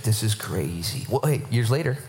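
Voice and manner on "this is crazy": low, slow amazement; mock dazed disbelief; awed disciples. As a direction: draw it out low, stunned.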